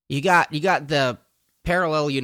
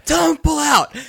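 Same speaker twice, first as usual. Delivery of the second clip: much higher, loud mock shout of the line; shouted one-liner; 9 dB louder than usual. Fan shouts M's favourite one-liner to his friend, mock shout. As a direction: belt the one-liner out for the laugh. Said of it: "what I love about one-liners".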